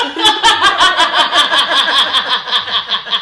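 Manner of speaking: loud real laughter breaking through; laughing. Real, not acted. Laughing fit while telling.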